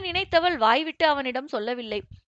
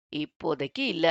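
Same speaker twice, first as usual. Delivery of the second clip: cool refusal; 7 semitones lower than usual. A narrator's reading. Boss coolly refusing.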